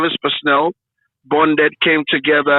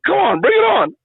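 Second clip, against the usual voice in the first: much higher, keen retold exclamation; eager retort; 13 semitones higher than usual. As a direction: replay the thrilled young man jumping at it. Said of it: "And I said".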